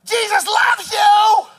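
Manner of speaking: high, loud, slow shout out the car window; buddy yelling out the window at rough guys. Retold or imitated. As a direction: call it out the window at full voice. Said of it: "and yell out".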